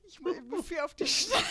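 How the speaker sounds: giggly, loud, struggling to speak; laughing through words. Real, not acted. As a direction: reassure while still cracking up. Cracking up over tissue remark.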